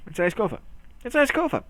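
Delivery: "kofa", couch and sofa run together, pitched high; blended-word slip. Shown as a demonstration. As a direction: repeat the mangled "kofa" as if it were a word. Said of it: "in the middle of saying it".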